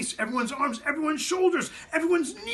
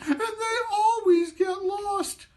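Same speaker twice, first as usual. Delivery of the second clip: very high, slow, sniffling wail; sniffling squeak; 4 dB louder, slower than usual. Acted. Matzo man telling the children his family name, sniffling high wail. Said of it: "sniffed the matzo man".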